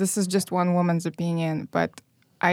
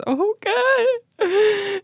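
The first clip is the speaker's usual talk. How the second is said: put-on whiny child. Mock child resigned crying.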